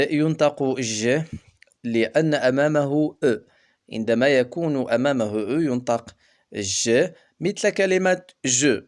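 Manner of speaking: ordinary talk, nothing marked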